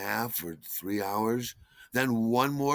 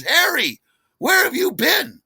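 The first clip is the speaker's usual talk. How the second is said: much higher, louder alarmed shout; alarmed wife; 10 dB louder than usual. Retold or imitated. Waking wife shrieks at husband coming home late, alarmed. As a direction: jolt awake and demand an answer. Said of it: "Suzanne bolts upright in her bed".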